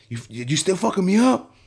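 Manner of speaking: stammering, high-pitched imitation of a paranoid client; rising, incredulous challenge; alarmed accusation. Client turns on barber a second time, alarmed.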